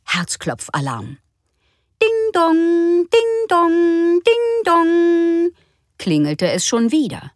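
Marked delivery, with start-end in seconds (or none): 2.0-5.5 high, louder sung chime; insistent doorbell. Acted. Narrator imitating the doorbell ringing on and on, louder.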